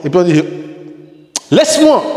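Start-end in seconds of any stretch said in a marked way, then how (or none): 1.5-2.0 high, forceful "Laisse-moi!"; raised volume. Shown as a demonstration. Teacher contrasting tone of voice, forceful high exclamation. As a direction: exclaim it sharply to push someone off. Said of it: "le volume et le ton de ma voix".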